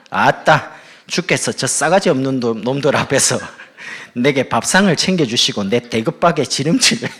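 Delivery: loud, hammed-up Jeolla dialect. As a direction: belt it out in thick Jeolla dialect. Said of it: "전라도 버전입니다".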